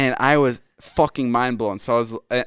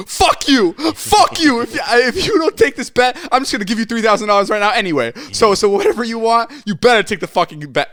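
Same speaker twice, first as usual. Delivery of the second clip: much higher, louder imitated furious yelling; furious Ilya; 12 semitones higher, 5 dB louder than usual. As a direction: bark it like a friend losing his temper. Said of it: "Ilya was so fucking furious".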